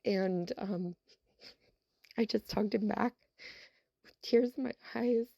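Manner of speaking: fighting tears while finishing the story; real emotion. Real, not acted. Teacher recalling the boy's thanks, choked up for real. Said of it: "this is where I get a little emotional".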